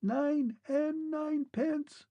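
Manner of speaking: very high, slow and distant; a last clear word from afar; thin distinct voice. A narrator's reading. Vanished Clockwork Man's last words, thin and far. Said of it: "very thin and distinct".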